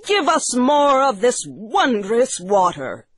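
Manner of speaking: high, urgent character voices; excited cry. Acted. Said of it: "they cried".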